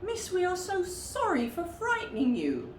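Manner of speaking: apologetic bear voice. A narrator's reading. Floppy ears apologises.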